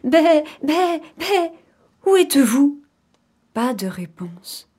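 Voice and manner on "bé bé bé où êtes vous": high bleating call; bleat, then call out high for the young; bleating mother goat. Acted. Mother goat searches for her kids, bleating call. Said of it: "Elle appela ses petits".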